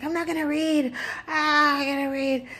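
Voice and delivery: mock the son's tantrum; whiny son. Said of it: "And he's like".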